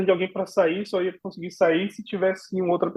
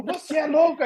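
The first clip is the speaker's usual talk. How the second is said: baker outraged; 10 semitones higher than usual. Retold or imitated.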